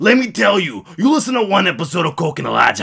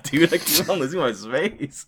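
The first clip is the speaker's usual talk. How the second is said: ordinary talk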